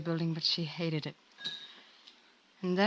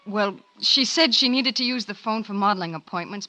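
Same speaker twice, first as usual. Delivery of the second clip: ordinary talk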